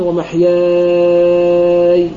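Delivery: ومحياي with the alif stretched six counts and the final ya stopped silent; long madd, silent ya. Shown as a demonstration. Teacher demonstrating the pause on ومحياي for students, drawn-out madd. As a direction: hold the alif of ومحياي very long, then close on a still ya. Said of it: "بالسكون مع المد اللازم ست حركات".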